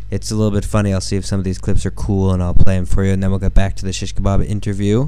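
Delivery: usual speaking voice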